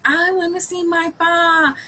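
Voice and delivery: acting out a little girl's plea; imitated crying child. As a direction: whine it like a tearful four-year-old. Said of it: "she's crying because she's like".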